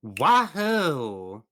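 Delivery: loud cheerful whoop; wahoo yell. Real, not acted. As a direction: cheer it out high. Cheering yell after a hit.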